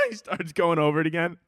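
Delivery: ordinary talk, nothing marked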